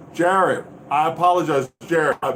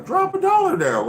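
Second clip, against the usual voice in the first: excited plea; 3 dB louder than usual. Real, not acted. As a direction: pitch jumps way up, pleading. Hyped plea for donations.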